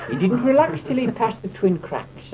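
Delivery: ordinary delivery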